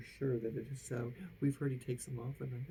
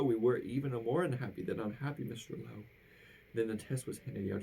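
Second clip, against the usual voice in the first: plain reading voice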